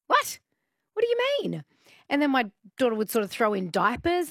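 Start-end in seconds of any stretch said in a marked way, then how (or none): 0.1-1.6 high-pitched, incredulous retort; mock-astonished, pitched way up; baffled disbelief. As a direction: act out being totally thrown. Retells her baffled reply to Joe.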